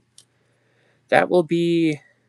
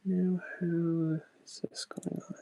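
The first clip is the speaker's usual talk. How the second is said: whispering; 10 dB quieter than usual. Real, not acted. Muttering to self while building.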